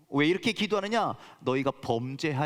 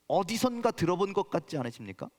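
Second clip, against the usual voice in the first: ordinary talk, nothing marked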